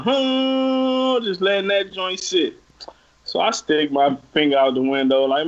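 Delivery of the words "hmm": long, high held honk; imitated car horn. Driver leaning on the horn behind him, imitated honk. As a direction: hum out a long blaring horn. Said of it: "He just laying on the horn".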